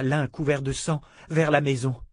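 plain reading voice

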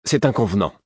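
reply loudly and primly, offended; scandalised, stiff reply; stiff retort. A narrator's reading. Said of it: "répliqua Ertre avec raideur".

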